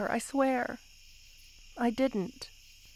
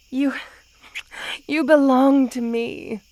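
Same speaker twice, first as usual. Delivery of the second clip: normal talking voice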